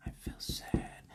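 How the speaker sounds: slow, barely audible whisper; faint inner voice; so quiet. Acted. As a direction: whisper it like a tiny knock. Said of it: "because it's so quiet".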